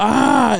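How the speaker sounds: high, drawn-out imitated yell; mock startled cry. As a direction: imitate a cry before fleeing. Uncle cries out, then runs.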